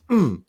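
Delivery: loud high surprised mm; a sudden loud hum of realization. Retold or imitated. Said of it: "she's like".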